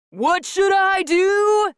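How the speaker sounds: high screamed question to crowd; screaming. A narrator's reading. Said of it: "he screamed".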